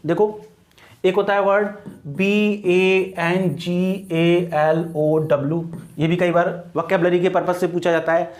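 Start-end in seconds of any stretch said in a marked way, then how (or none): 2.1-5.6 very slow letter-by-letter dictation; measured classroom spelling; spelled out slowly. Shown as a demonstration. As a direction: give each letter its own space. Spelling out word for students.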